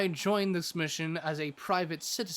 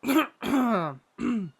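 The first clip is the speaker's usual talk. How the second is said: loud throat clear, pulling himself together; 6 semitones higher, 7 dB louder than usual. Acted. Adventurer clearing his throat to move on, loud throat clear. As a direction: clear your throat hard to shake off the feeling. Collecting himself after emotion.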